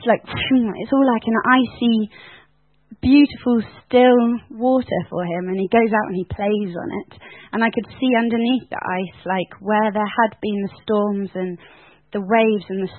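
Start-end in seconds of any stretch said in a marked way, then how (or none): none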